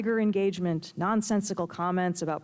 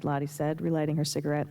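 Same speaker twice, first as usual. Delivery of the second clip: ordinary delivery, nothing marked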